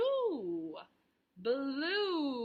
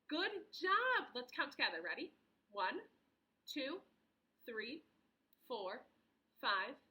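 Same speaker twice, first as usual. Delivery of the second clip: kindergarten teacher voice; 3 semitones lower, 5 dB quieter than usual. Shown as a demonstration. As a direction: count along gently with the kids. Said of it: "So let's count together the sides".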